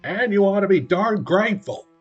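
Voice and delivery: angry monster mother growling; growled. Acted. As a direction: put him in his place. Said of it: "Ma Gasket growled".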